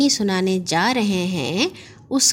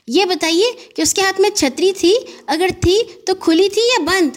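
higher, fast, eager question; excited jump; 10 semitones higher than usual. Acted. Gaggu jumps up excitedly asking his uncle. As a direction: blurt it out as he jumps up. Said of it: "اچھل کر بولے".

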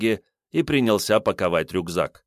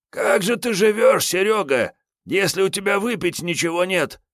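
hungover Kiryay; 11 semitones higher than usual. Acted. Hungover Kiryay begging Sergey for a drink, high whine. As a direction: moan about the hangover, begging a drink.

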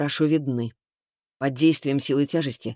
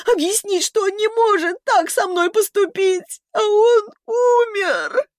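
high tearful wail, climbing in pitch; hysterical sobbing; 11 semitones higher, 4 dB louder than usual. A narrator's reading. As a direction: wail it out, building to a loud, drawn-out cry. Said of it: "бурно и некрасиво зарыдала в голос".

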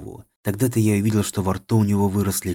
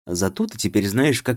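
ordinary delivery, nothing marked

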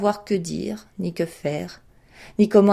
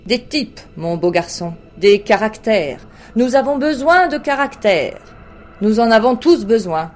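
loud, swelling royal demand, pitch climbing; roi exubérant; 3 semitones higher, 5 dB louder than usual. A narrator's reading. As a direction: build to a booming, high-pitched command. (roi qui s'emballe, voix de plus en plus forte)

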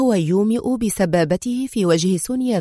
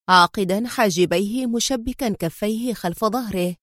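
ordinary delivery

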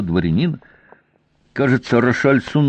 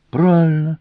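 high-pitched pleased approval; light up at the right answer; delighted; 7 semitones higher than usual. A narrator's reading. Commander hears the right wine named, delighted. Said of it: "обрадовался главнокомандующий".

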